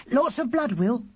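put-on very high, eager child's voice; piercing little brother. A narrator's reading. Charlie plying his brother with questions, piercing. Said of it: "as loud and piercing as ever".

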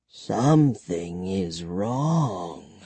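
very slow, low, soft repetition; soft vampire voice. A narrator's reading. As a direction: murmur it gravely, stretched out. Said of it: "the vampire repeated softly".

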